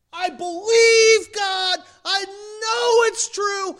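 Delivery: shouted, drawn-out, high-pitched declaration of belief; loud profession. Acted. Preacher mimicking professed faith to the church, shouting it out high. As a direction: proclaim it at full volume, stretching the key words. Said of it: "I don't care how much we profess".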